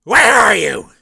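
loud, high barking chief; gruff police chief. Acted. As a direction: bark like a surly boss.

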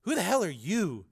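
high, loud challenge; indignant shout. A narrator's reading. Reporter challenging her rival, indignant shout. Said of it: "Linda shouted".

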